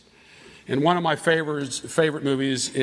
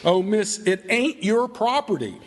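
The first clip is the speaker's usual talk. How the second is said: high, drawn-out pleading that falls away on "miss"; acted-out wail of protest; pleading drunkard; 5 semitones higher than usual. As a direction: beg desperately through withdrawal pains. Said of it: "going through, uh, some withdrawal pains here, and he says".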